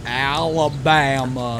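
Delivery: very low, slow drawn-out Alabama twang; mock Alabama accent. Guessing at the accent's origin.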